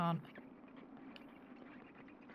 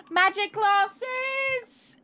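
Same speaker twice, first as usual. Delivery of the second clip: gasping delight; 10 dB louder, much slower than usual. Real, not acted. Streamer thrilled the glasses let him see. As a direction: gasp then a high slow marvel. Discovers glasses light the game.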